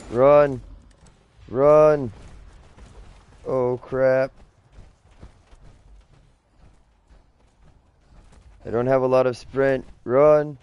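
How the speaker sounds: loud, high panicked yelling; being chased. Real, not acted. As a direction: yell in a panic while fleeing. Creature chasing player in game.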